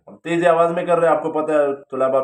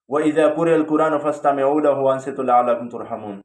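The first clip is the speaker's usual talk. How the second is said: Quran recitation; 2 semitones lower, faster than usual. Retold or imitated. Cleric quotes Quran verse in Arabic.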